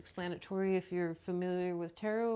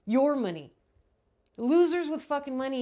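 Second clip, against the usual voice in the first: louder, higher jab at money; angry outburst; 3 semitones higher, 6 dB louder than usual. Real, not acted. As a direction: spit it out with contempt. Ranting about rich losers.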